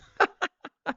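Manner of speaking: short burst of high laughter. Real, not acted. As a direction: laugh at your own absurd memory. Laughing at his own memory.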